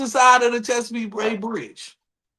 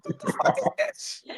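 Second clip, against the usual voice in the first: laughing disbelief; 7 dB quieter than usual. Retold or imitated. Mock reaction to downtown New Orleans.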